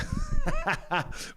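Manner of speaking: high burst of laughter; laughing. Real, not acted. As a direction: crack up at your own tech mess. Laughs at the botched screen setup.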